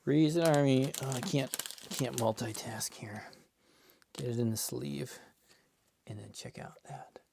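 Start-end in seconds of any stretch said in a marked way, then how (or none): 1.0-5.2 whisper. Real, not acted. Whispering while sorting cards. 6.1-7.2 whisper. Real, not acted. Whispering over cards.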